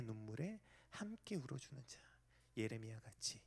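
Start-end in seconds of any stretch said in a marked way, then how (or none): none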